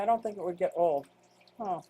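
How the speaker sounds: ordinary talk, nothing marked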